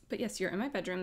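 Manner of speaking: usual speaking voice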